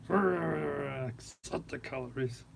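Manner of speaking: slow, drawn-out mock mantra. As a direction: intone it like a calm guru. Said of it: "mock mantra, slowed right down".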